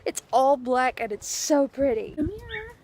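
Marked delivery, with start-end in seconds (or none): 2.2-2.7 very high, soft coaxing call; cat-calling voice. Real, not acted. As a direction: sweet-talk the kitty in a high sing-song.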